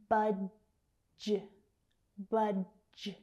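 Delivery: "Budge" as BUD... juh; split at J. Shown as a demonstration. Teacher breaking down a word, "Budge" split as bud, juh. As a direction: pause before the J sound in "Budge".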